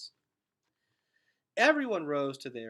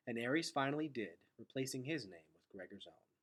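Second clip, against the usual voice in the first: ordinary delivery, nothing marked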